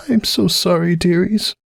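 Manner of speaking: high, mildly hoarse apology. A narrator's reading. Cupcake recovering from hysterics, hoarse apology. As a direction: apologize as if it hurts to speak. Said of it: "Her voice was hoarse".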